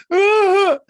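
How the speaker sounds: very high, loud laughing shriek; sudden outburst. Retold or imitated. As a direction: re-enact suppressed laughter bursting out as one piercing cry. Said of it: "all of a sudden I go".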